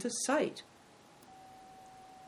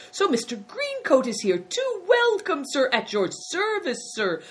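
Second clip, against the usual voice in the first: high-pitched fawning innkeeper; crying out joyfully; 12 semitones higher, 4 dB louder than usual. A narrator's reading. Innkeeper welcoming Greencoat, high effusive cry. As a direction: gush a delighted welcome way up high. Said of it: "cried the innkeeper".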